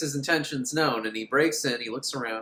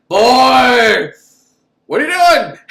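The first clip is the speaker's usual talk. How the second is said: much higher, louder shouted threat; mock menacing yell; 8 semitones higher, 14 dB louder, slower than usual. Tall man catching the boy, loud shouted threat. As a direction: roar at the boy like a villain. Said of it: "tall man catches the boy".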